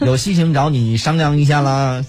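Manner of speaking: put-on southern Chinese accent. Fake police officer demanding cooperation, mock southern Chinese accent. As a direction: imitate a southern Chinese accent, stretching each 啦. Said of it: "操着南方话说".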